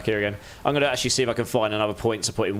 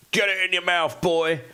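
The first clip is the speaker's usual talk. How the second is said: high, put-on voice ordering himself; mock command; 6 semitones higher than usual. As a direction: egg yourself on in a silly high voice.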